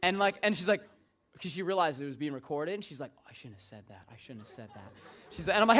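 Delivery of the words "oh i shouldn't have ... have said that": very soft, low murmur; regretful aside. Retold or imitated. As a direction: say it under your breath, as if recorded. Caller regrets remark on recorded line.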